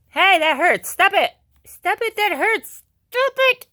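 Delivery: loud, high yelp of protest; protesting in pain. Real, not acted. Puppy bites, speaker protests.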